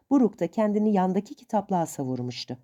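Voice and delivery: plain reading voice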